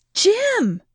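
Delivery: urgent repeated call to a sleeping husband; calling out again. Acted. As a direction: call out sharply to wake him. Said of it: "I called again".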